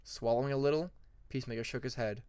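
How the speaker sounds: ordinary delivery, nothing marked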